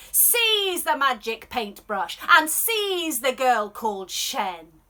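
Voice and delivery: loud, hammed-up royal bellow; bellowing emperor. Emperor bellows to his men to seize Shen, loud. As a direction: roar the order at the guards. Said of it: "He bellows to his men".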